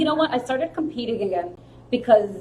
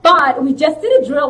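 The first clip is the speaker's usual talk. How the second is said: loud, raised-pitch insisting; insistent pleading; 4 semitones higher, 8 dB louder than usual. Retold or imitated. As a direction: push back eagerly at a reluctant friend. Insistent retold reply to friend.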